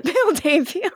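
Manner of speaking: words squeezed out through laughter; real giggling speech; laughing. Real, not acted. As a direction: crack up while talking, pitch climbing. Cracking up at invented twin.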